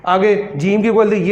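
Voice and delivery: ordinary talk, nothing marked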